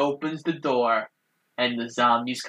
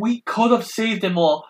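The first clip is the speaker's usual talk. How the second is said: higher, mock-angry accusation; hammed-up reproach, pitched up; retold angry reproach; 7 semitones higher than usual. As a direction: imitate an outraged father confronting someone. Repeats father's angry accusation.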